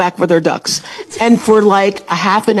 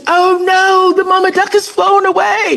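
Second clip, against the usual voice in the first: mock panicked shout; 10 semitones higher than usual. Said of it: "So he goes".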